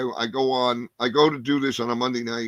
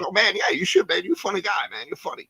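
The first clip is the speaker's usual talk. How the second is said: a lot higher, lightly voiced hyped-up older comic; excited encouraging comic; 7 semitones higher than usual. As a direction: pump the kid up, like a friendly pro.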